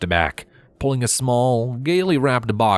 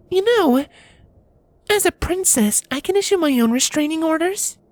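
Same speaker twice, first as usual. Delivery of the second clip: high mare voice, cool and pointed; icy warning from a princess; frosty Cadance. A narrator's reading. Cadance coldly warning her husband, high voice. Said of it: "she said frostily".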